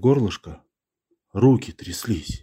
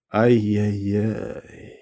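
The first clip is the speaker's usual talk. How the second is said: soft, slow, drawn-out marvelling; admiring; 6 dB quieter, much slower than usual. A narrator's reading. Old man marvelling at the weight of gold, awed. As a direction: marvel quietly, stretching it out. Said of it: "восхитился дед".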